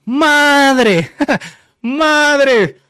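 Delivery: high, loud, drawn-out exclamation; retold dismay. Telling how the water got cut, in loud mock dismay. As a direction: stretch it out in comic alarm.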